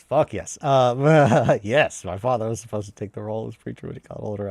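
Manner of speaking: ordinary delivery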